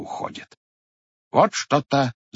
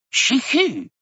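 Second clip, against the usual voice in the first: high sudden sneezed чихи; sneeze sound; 13 semitones higher than usual. Acted. Unseen devil sneezing over grandfather's head, high sneeze. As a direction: burst out a sharp high sneeze. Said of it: "Чихнуло что-то так".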